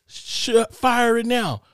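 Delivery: high, loud mock taunt; mocking the laughing taunt. Retelling the man who dared the gunman, in a high mocking voice. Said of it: "He starts to laugh and says".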